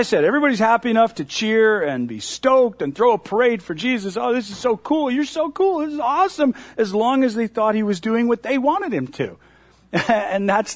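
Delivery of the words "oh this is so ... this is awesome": mock cheering fan. Crowd cheering Jesus at parade.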